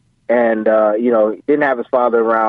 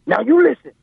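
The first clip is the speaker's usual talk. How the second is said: high-pitched imitation of a stern father; stern dad; 13 semitones higher than usual. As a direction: demand attention as the dad. Retold dad laying down rules.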